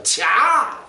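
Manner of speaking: short Gyeongsang-dialect bark of 차. Retold or imitated. King shooing off the priests, in a Gyeongsang-dialect bark. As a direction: spit out 차 the Gyeongsang way. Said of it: "경상도 말로".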